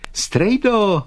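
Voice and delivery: put-on boy calling back high and loud; high-pitched holler; loud answer. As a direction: shout back to an uncle across the yard. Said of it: "odpovídal Vítek nahlas".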